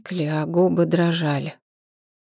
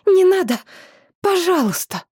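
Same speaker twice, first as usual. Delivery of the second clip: desperate pleading shout; 13 semitones higher, 4 dB louder than usual. A narrator's reading. Begging the dragon to stop.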